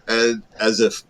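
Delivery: ordinary talk, nothing marked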